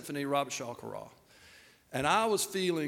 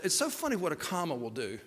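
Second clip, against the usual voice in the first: ordinary delivery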